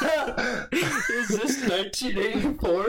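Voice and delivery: high laughter, words very slow; squeezed out through laughs; cracking up at his own thought police joke; laughing hard. Real, not acted.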